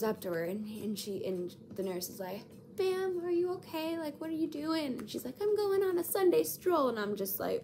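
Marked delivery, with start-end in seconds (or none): 2.8-5.0 high-pitched mock nurse voice; concerned nurse. Acting out nurse checking on patient. 5.4-6.9 high-pitched mock old-lady voice; confused old lady. Acting out confused elderly patient.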